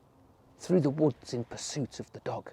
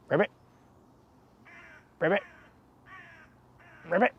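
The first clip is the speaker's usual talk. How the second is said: frog croak; 8 semitones higher, much faster than usual. A narrator's reading. Frog sound in story.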